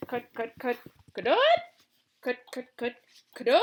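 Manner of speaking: high clucking hen cackle. A narrator's reading. Billina the hen calling out from under the throne, clucking. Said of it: "a shrill voice suddenly cried out".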